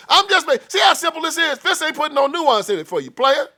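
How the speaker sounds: high, loud preacher's yell; preaching shout. Real, not acted. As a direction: hammer it home at full voice.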